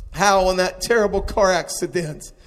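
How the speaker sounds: normal talking voice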